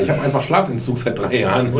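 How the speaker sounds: normal talking voice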